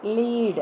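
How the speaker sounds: "Lead" with a long ee, like leed. Shown as a demonstration. Teacher answers how we pronounce this, long-ee "Lead". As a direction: say "Lead" to rhyme with need. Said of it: "how do we pronounce this".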